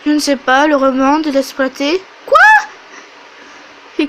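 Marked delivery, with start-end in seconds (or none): none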